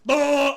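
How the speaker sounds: loud, long buzzing blare; drawn-out loud mock bass blast; imitated amp noise. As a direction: blurt the noise of a yanked cable. Imitates cord pulled from bass.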